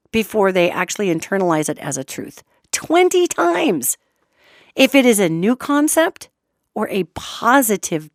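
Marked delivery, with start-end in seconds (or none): 2.7-4.0 "Twenty times" high and drawn out; emphatic repetition. Real, not acted. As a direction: hammer home "Twenty times" slowly, pitched well up. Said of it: "I just keep repeating that".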